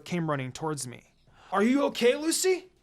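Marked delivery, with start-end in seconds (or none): 1.5-2.7 high, loud anxious call; worried yell. A narrator's reading. Teammates calling to fallen Lucy, loud and high. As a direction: shout her name in alarm. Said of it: "they both yelled".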